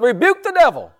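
high, loud mock-revival cry; hammed-up old Pentecostal prayer shout; old-time Pentecostal. Preacher imitating old Pentecostals for the church, high loud cry. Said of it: "like the old Pentecost used to say".

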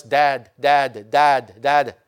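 lightly voiced baby calling for dad; a hint of a small child babbling Dad; mild imitation of a toddler calling Dad; toddler's first words. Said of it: "their first words always".

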